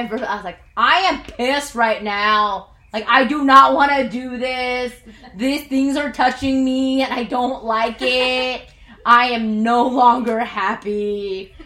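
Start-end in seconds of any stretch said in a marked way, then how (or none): 0.8-4.9 louder, fed-up complaining; re-enacted furious hiker; retold angry rant. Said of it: "I was so angry 'cause I was like". 5.3-7.0 things said louder, upset and higher; louder retold protest on the trail; angry complaint. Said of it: "I was so angry". 7.0-11.5 louder, slow, fed-up; slow, loud grumbling at him; retold angry rant. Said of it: "I was so angry".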